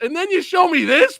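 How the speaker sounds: normal talking voice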